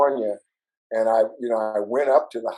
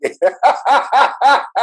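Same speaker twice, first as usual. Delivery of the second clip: laughing; 7 dB louder than usual. Real, not acted. Laughing at the story.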